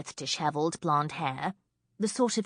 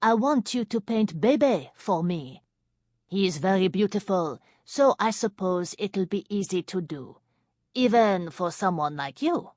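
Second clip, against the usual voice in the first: put-on thick French accent; 3 semitones higher, 6 dB louder than usual. A narrator's reading. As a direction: introduce yourself in smoky French. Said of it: "in a French accent as thick as nightclub smoke".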